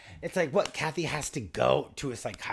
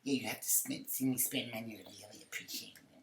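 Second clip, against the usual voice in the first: mock [name] as Nikki; 6 dB quieter than usual. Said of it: "she's like".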